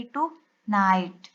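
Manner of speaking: टू नाइट said slowly with a gap between टू and नाइट; split into syllables. Shown as a demonstration. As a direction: separate टू from नाइट, unhurried. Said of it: "इस consonant से पहले हम इसको divide कर देंगे". (टू नाइट को दो हिस्सों में धीरे बोलें)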